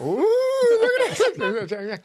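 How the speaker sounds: high, slow mocking ooh; loud teasing ooh. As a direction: drag it out like a teasing kid. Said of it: "Loudly and boisterously".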